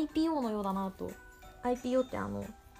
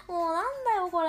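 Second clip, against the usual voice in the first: laughing; 6 semitones higher, 6 dB louder, faster than usual. Real, not acted. Laughing at own rambling.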